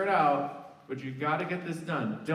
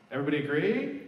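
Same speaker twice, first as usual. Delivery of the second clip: ordinary talk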